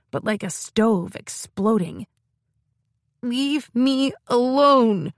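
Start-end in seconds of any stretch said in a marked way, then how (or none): 3.2-5.1 high, loud, slow wailing scream. Acted. Girl screams at her sister to leave her alone, wailing. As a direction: scream it out, higher, dragging each word. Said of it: "she screamed".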